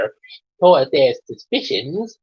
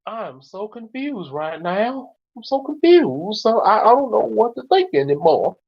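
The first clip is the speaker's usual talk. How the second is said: hammed-up helpless confusion; bewildered; 6 semitones higher than usual. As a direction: wail it in mock bewilderment. Acted confused character in the skit.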